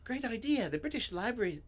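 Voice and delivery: lightly put-on high character voice; eager story character.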